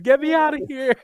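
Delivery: high-pitched playful wail; mock scream. Fighter with a stomped toe begging out, mock scream. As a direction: cry out high, hamming it up. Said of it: "I'm gonna be screaming out to the Lord".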